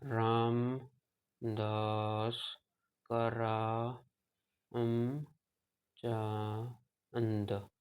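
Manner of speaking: "Ramdas Karamchand" drawn out extremely slowly and softly; very slow scanning. Shown as a demonstration. Teacher imitating a patient answering his name, extremely slow. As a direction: stretch each syllable of the name out. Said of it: "ऐसे बोलेगा".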